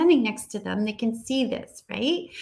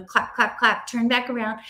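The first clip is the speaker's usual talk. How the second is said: ordinary talk, nothing marked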